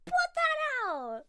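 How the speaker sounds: shouting round a big sweet; muffled yell, mouth stuffed; mouth full. Real, not acted. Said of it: "encompassing the entirety of my mouth".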